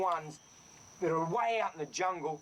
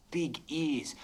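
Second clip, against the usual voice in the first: usual speaking voice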